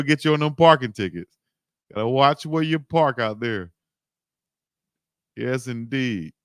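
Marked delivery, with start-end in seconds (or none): none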